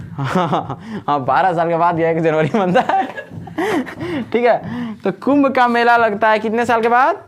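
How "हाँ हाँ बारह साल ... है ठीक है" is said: real laughter breaking into the words; laughing. Real, not acted. Teacher laughing at a pupil's answer about January, laughing. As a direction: repeat the answer while cracking up.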